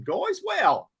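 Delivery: sudden high surprised wow on spotting the viewer count. Real, not acted. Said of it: "Only just noticed that".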